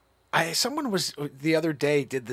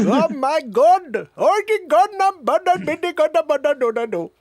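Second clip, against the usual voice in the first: high, loud comic wail; mock panicked caricature; 10 semitones higher, 6 dB louder than usual. Host playing flustered protester, high hammed-up wail. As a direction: wail and stammer in exaggerated protest.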